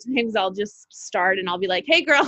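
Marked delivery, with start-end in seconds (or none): 1.9-2.2 high, louder hello to a best friend; girlfriend greeting. Acted. Starting a video as if FaceTiming a best friend, high girlfriend greeting. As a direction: greet the camera like a close girlfriend, pitched up. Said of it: "on FaceTime with one of my best friends".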